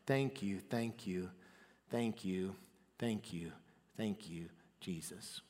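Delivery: hushed prayer demo; quiet prayer. Shown as a demonstration. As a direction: pray them gently, almost inward. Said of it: "This is what it will sound like in your heart".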